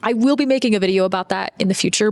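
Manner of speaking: usual speaking voice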